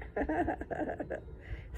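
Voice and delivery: airy giggle of amusement; unvoiced breathy laugh. Real, not acted. Laughs at own slip.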